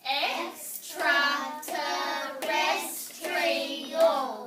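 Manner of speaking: EXTRATERRESTRIAL loud, very slow, in separate beats; loud classroom model of EXTRATERRESTRIAL in parts; syllable by syllable. Shown as a demonstration. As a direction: chant EXTRATERRESTRIAL one syllable at a time. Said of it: "Say it with me".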